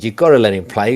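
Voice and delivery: loud insistent call; retold shout. Uncle insisting to the organiser, loud retold shout. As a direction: push the organiser to let him in. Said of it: "he shouted".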